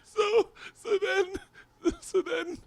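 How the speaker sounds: helpless high giggly restart; cracking up, words stretched out; laughing too hard to talk. Real, not acted. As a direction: try to resume the story through real laughter. Struggles to resume story through laughter.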